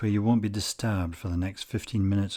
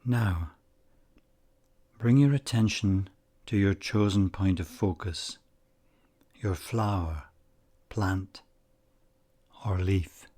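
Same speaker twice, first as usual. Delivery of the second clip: unhurried meditation guide, long pauses between phrases; calm, measured guiding voice, phrase endings gently falling away; guided meditation. Acted. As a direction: ease the listener into calm focus, leaving space after each phrase.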